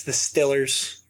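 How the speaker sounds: "Stillers" with a short i; Pittsburgh pronunciation. Shown as a demonstration. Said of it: "when you get into the heart of Pittsburgh".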